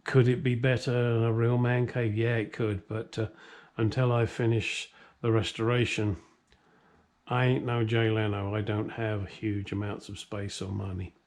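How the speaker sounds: usual speaking voice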